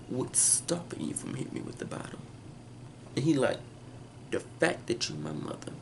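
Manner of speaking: hushed and breathy, close to a whisper; a cold low threat between mother and son; hushed menace. Retold or imitated. Tense mother-son exchange voiced.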